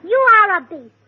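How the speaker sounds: a mock-furious scold. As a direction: tell him off. Wife scolding husband.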